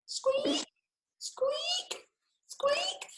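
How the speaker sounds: very high whispered squeaks; high, whispered mouse squeak; tiny squeak. Acted. Mystery squeak.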